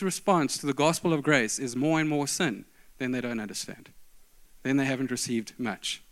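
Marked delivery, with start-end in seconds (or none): none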